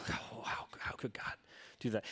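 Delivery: whispered, aghast question; a hushed, scandalised murmur; shocked whisper. Retold or imitated. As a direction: breathe it out in disbelief. Voicing listeners' shocked doubt.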